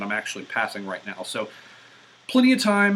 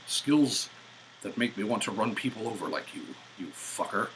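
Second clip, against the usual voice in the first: lightly voiced low, menacing Taken threat; a hint of gravelly action-hero menace, half joking; Liam Neeson impression; 3 semitones lower than usual. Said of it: "that was my best Liam Neeson".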